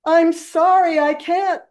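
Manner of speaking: sorry, helpless, drifting away; flustered wizard. Retold or imitated. Wizard calling down to Dorothy from the balloon, high and helpless.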